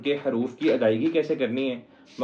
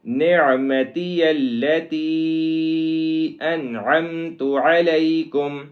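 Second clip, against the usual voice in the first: slow melodic recitation; tajweed recitation; 2 semitones higher, 4 dB louder, much slower than usual. Shown as a demonstration. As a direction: chant the phrase, vowels drawn. Recites verse phrase. (تجوید کے ساتھ تلاوت)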